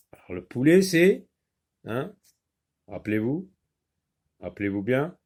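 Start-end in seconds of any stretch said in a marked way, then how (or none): none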